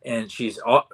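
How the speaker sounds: ordinary delivery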